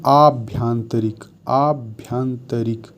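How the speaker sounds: आभ्यंतरिक slowly, long aa at the start; careful pronunciation. Shown as a demonstration. Teacher drilling students on आभ्यंतरिक, slow and careful. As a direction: spell out आभ्यंतरिक syllable by syllable with a long aa. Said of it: "अभ्यंतरिक नहीं".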